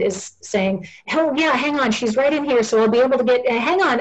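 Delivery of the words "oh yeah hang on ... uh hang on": higher, hurried chatter into a phone; acted caller-juggling staffer, pitched up; busy staff member. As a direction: rattle along brightly as a bustling care worker. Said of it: "a staff member comes in with a phone".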